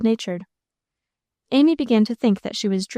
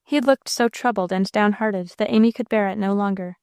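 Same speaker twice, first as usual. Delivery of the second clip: ordinary delivery, nothing marked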